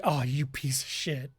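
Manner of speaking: low, soft grumbled insult; muttered curse. Pretend. Player cursing the teleporting boss, low quiet grumble. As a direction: mutter it under your breath. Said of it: "he's just like".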